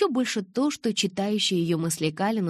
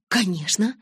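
louder, higher eager confirmation; 4 semitones higher, 5 dB louder than usual. A narrator's reading. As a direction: confirm it with heat. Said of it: "жаром кивнула подруга".

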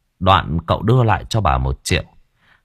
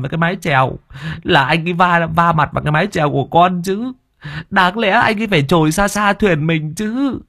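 high, whiny self-defence; defensive excuses; 8 semitones higher than usual. Acted. Servant excusing himself to his master, high whiny. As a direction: whine high, blaming others. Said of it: "phân trần".

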